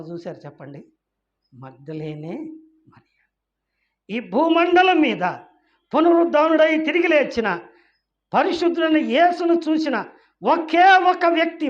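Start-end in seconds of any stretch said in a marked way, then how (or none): 4.1-11.7 climbing higher and louder, preacher's cry; preacher rising to a loud rebuke; fiery preaching. Acted. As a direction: build the rebuke up to a shout. Preaching at full force.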